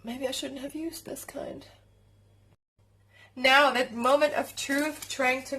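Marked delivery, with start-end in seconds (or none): 0.0-1.6 unvoiced whispered aside. Real, not acted. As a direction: whisper the doubt to yourself. Doubting aside to camera.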